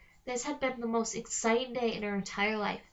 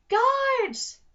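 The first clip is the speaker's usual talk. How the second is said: loud, much higher bellow for the guards; hollering; 13 semitones higher, 8 dB louder than usual. Acted. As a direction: yell across the dungeon. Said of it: "Trobella hollered".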